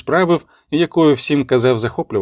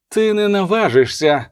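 raised pitch, understated outcry; mild cry of protest; 6 semitones higher than usual. A narrator's reading. Abbess defies the prince's order to search, restrained cry. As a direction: protest higher without a full shout. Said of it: "крикнула Абатиса".

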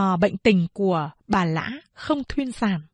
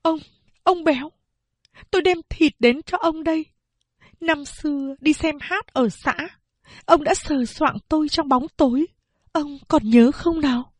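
higher, lightly voiced woman confronting him; a hint of a bitter young wife; lightly voiced mother; mother's voice; 7 semitones higher than usual. A narrator's reading. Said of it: "Mẹ nói".

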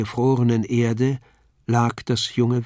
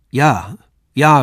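a loud, eager call of yes; 2 semitones higher, 7 dB louder than usual. A narrator's reading. Young man calls out to the kneeling stranger, loud. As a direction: call it out to lift her spirits. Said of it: "rief ich".

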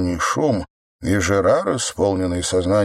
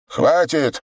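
barking shout; 8 semitones higher than usual. Acted. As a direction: snap it out as a command. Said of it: "рявкнул коп".

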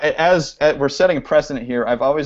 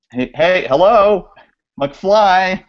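raised, higher calling voice; playful hey-anyone-home call; 7 semitones higher, 6 dB louder, much slower than usual. Acted. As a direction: holler to someone who isn't answering. Calling out to absent guest.

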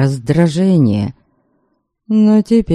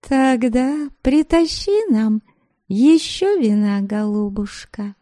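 haughty order; 6 semitones higher, 4 dB quieter than usual. Acted. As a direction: order her about with contempt. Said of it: "приказала рыжая девица".

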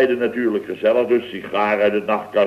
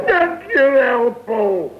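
pained thanks; 12 semitones higher, much slower than usual. Acted. Thanked after being shot.

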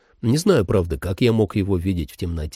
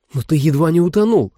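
higher, louder worried woman; woman's voice; 7 semitones higher, 4 dB louder than usual. Acted. Holly objects.

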